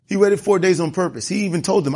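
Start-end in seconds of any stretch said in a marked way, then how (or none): none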